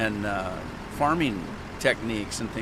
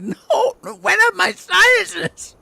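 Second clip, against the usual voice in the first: choking half-sob; 9 dB louder, slower than usual. Retold or imitated. Choking on tea, telling wife.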